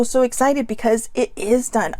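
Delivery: usual speaking voice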